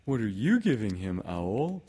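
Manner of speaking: lively storybook bear question; Pooh. Acted. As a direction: ask eagerly in a little bear's voice. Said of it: "said Pooh".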